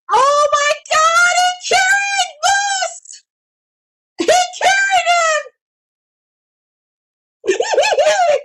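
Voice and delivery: high, drawn-out shout; screaming in disbelief. Real, not acted. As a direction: scream it out in amazement. Live reaction to a carry.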